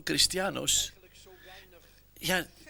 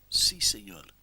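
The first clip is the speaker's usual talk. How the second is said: much lower, deflated answer with enthusiasm draining; subdued reply; 8 semitones lower, slower than usual. Retold or imitated. Peter answering Christ a second time, subdued and low. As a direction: let the eagerness drain out, low and heavy. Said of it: "quizás está bajando el entusiasmo".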